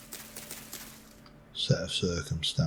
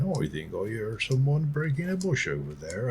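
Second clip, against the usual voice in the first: higher, put-on West Country lilt; bad Cornish accent; 3 semitones higher than usual. Lurking predator hearing someone breaking a bush, in bad Cornish accent. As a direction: do a hammy generic Cornish accent. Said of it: "why they've got a Cornish accent".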